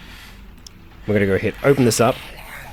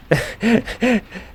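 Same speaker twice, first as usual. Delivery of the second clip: high burst of laughter. Real, not acted. Laugh at the umbrella.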